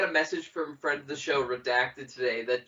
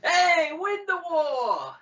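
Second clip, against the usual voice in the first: high, slow New York yell; Babu Frick voice; 13 semitones higher, 5 dB louder, much slower than usual. Retold or imitated. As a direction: shout it like a cartoon New Yorker. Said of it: "in Babu Frick's voice".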